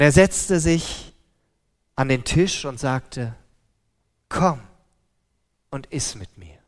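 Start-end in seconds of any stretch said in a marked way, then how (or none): 4.3-6.6 tender invitation. A narrator's reading. Jesus invites the woman gently.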